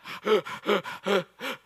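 rhythmic labored gasps; imitated panting. Comedian running while neighbor talks, acted panting. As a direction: pant as if running too fast.

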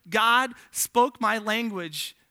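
each word slow and weighty; slow emphasis. Real, not acted. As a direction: land it slowly. Slows for key line.

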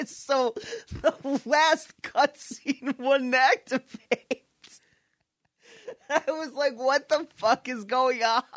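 high, laughing exasperation; laughing disbelief. Real, not acted. Telling friends about the glitch, laughing in disbelief.

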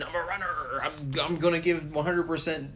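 high, eager runner voice; hyped runner. Retold or imitated. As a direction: pump yourself up like a gung-ho runner. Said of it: "And he's like".

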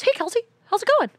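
bright stranger greeting at the store; cheery guy. Retold or imitated. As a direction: chirp it like an overfriendly acquaintance. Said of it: "this guy goes".

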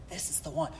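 soft, low confiding murmur; hushed hint. Retold or imitated. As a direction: tip dad off quietly. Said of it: "And she's like".

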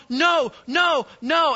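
high-pitched calls of protest; collective alarm. Acted. Everybody watching him follow her, alarmed cries. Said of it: "Everybody's going".

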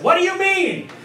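high-pitched exasperated question; incredulous demand. Retold or imitated. Husband questioning in a phone quarrel, high incredulous demand. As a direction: demand an explanation in comic disbelief.

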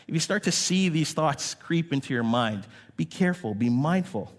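ordinary delivery

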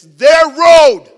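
very loud, high and drawn out; hammed-up outraged holler; exasperated shout. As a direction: yell it slowly in indignation. Retells scolding the honking driver.

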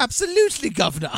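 an overeager cockney cabbie fawning over his fare; over-the-top cockney. Retold or imitated. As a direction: squeal it like an overeager cockney cab driver. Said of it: "He's just, like, so over the top".

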